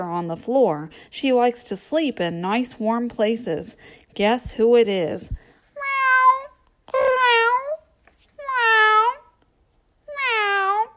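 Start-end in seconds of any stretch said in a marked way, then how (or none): 5.7-10.8 high, sweet mewing cat; cat meow. Acted. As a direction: meow like a little kitten for the kids. Cat sound in guessing book.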